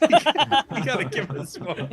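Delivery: loud laughing plea. Real, not acted. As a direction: laugh through the words, begging.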